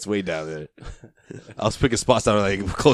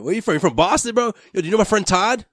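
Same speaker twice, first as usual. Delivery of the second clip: a light mock local, higher and pushy; mock Boston local; 11 semitones higher, 3 dB louder than usual. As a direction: lightly play a pushy townie quizzing someone. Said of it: "It's like".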